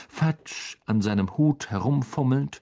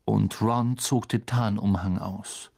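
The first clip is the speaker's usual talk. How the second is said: plain reading voice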